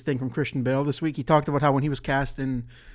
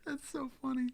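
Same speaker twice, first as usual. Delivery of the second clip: giggling through the words; can't stop laughing; 12 semitones higher than usual. Real, not acted. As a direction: laugh it out high and breathy. Laughing while reading clip.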